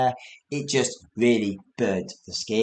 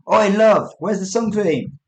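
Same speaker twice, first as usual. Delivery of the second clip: raised voice calling across the house; 8 semitones higher, 6 dB louder than usual. Retold or imitated. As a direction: call out to the wife. Calling to wife for sun cream.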